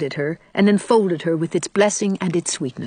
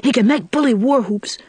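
regretful; 5 semitones higher than usual. A narrator's reading. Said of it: "said Davy a little regretfully".

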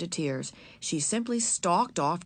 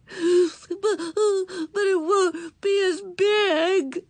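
put-on high, slow sobbing child; 14 semitones higher, 6 dB louder, much slower than usual. A narrator's reading. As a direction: sob it out, voice breaking. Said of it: "sobbed Ramona".

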